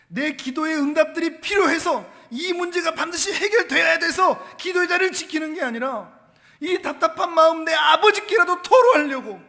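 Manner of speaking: high, loud, driving sermon delivery; impassioned preaching. Real, not acted. Preaching at full force.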